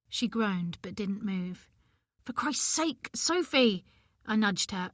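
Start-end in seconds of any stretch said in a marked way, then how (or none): 2.2-3.9 irritated, higher snap; fed up and impatient; exasperated. Acted. Exasperated with sleeping friend.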